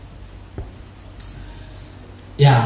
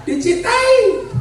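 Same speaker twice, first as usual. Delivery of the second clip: high, stretched-out punchline; high drawn-out answer; much slower than usual. Real, not acted. Preacher answering his own question to the congregation, high and drawn out. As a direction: draw the word out high and slow.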